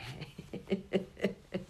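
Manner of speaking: a quick airy chuckle; soft breathy laugh. Real, not acted. Amused at the brain fearing death, a soft airy laugh. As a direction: laugh softly under the breath.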